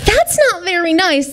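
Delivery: high, gently scolding princess; lightly voiced storybook princess; magnanimous princess. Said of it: "the magnanimous, beautiful princess said".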